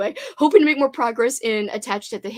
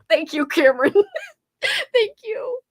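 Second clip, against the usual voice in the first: laughing thanks; 10 semitones higher, 3 dB louder than usual. Real, not acted. Touched by viewer's kind words.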